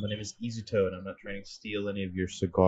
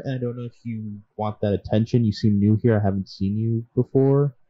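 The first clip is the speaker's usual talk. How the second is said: raised voice, trying to calm him; louder and louder, talk him down; loud pleading; 6 dB louder than usual. Acted. Player calling over the crowd to the nobleman, raised and pleading.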